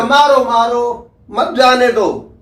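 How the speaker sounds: ordinary delivery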